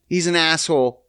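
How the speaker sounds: usual speaking voice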